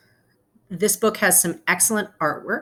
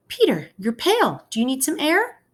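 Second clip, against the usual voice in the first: higher, worried motherly voice; fussing mother; 10 semitones higher than usual. Acted. Mother worrying over pale Peter, higher fussy voice. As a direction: fret over the boy like a mother.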